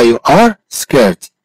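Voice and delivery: slow, word by word, with a gap before "scared"; slow model. Shown as a demonstration. Teacher models "you are scared" for a student, slowly. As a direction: stretch "are", then say "scared" separately.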